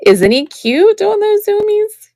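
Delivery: high, laughing coo over the pet. Real, not acted. A fond laughing coo at her running pet. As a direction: gush at him with a laugh. Cooing over a pet's zoomies.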